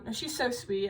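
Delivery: normal talking voice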